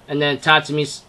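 ordinary talk

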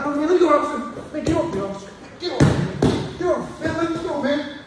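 acted suspect panicking and yelling at the officer; mock panicked yelling. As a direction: yell and panic like you don't want to go to jail. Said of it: "yelling, panicking".

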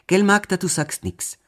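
high, loud opening that eases off; hasty assurance. A narrator's reading. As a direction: press the girl for agreement, acted. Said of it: "versicherte Johann eilig". (eilig beschwörend)

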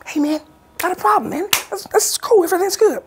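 very high, breezy friendly producer; chill producer voice. Retold or imitated. Producer to late comedian, very high breezy reassurance. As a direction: play it easygoing and sweet.

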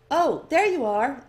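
bright curious clerk sizing up the newcomer; eager elf librarian. A narrator's reading.

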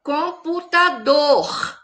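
"Computador" very slowly syllable by syllable, longest on "dor"; strong last syllable. Shown as a demonstration. Teacher separating a word for pupils, "Computador" slow, stressed on "dor". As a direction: break up "Computador" and lean on "dor". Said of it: "Qual que foi a sílaba forte".

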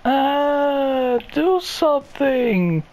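high, slow pleading whine; high whiny girlfriend. Acted. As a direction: beg the boyfriend in a whine.

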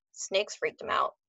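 usual speaking voice

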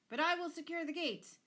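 high, loud counter-threat; angry bellow. A narrator's reading. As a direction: top her with a shouted threat. Father's threats escalate in quarrel.

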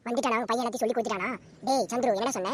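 ordinary talk, nothing marked

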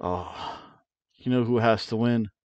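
ordinary talk

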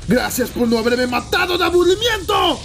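very high, loud yelled taunt; full-force high-pitched shouting; battle taunt shout. Acted. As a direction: scream it at your opponent. Yelling at attacking monster.

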